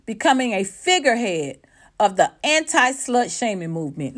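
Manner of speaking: normal talking voice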